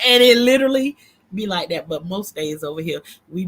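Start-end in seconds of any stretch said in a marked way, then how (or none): 0.0-1.0 words pushed out through laughter; giggling through the line; laughing. Real, not acted. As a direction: laugh while you say it. Laughing at her own joke.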